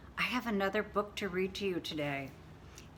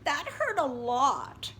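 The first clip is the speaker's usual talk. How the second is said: hurt little bird; 6 semitones higher, 4 dB louder than usual. Acted.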